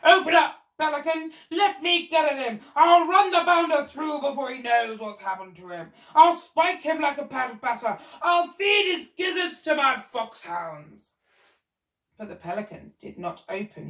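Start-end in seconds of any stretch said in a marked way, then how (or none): 0.0-11.1 loud, high bluster, slowing at the end; blustering shout. Acted. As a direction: bellow threats like an old colonel. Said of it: "he shouted".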